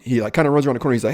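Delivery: ordinary delivery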